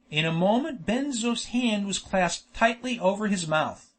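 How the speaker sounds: ordinary delivery, nothing marked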